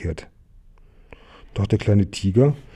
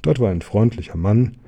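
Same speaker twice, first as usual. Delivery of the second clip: ordinary delivery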